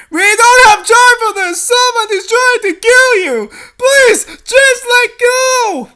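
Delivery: high, loud, slowing urgent plea; desperate shouted plea. Acted. Rescuer shouts up begging her to let go. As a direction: beg her to let go before it's too late.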